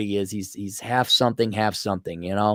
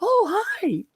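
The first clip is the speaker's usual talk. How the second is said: put-on gushing girl. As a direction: squeal it as an imitation of a smitten girl. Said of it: "And goes like".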